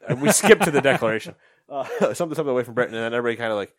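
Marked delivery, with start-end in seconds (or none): none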